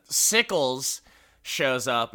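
normal talking voice

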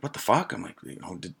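high, hushed confusion; can't believe he took a cab; incredulous and softly high; baffled disbelief. Retold or imitated.

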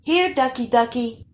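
high, coaxing call to ducks; cooing. A narrator's reading. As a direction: coo it to lure them closer. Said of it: "cooed the ash lad".

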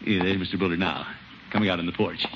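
normal talking voice

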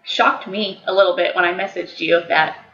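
laptop mic. Real, not acted. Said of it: "the computer microphone was the microphone that was used".

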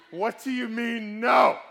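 high, drawn-out mock-furious yell; indignant shout. Father's imagined answer to his little daughter, indignant shout. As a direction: explode in acted outrage, building to the last word.

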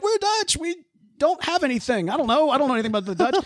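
high-pitched mock pleading; panicked crew. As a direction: plead innocence in a high, comic voice. Crew pleads with attacking pirates.

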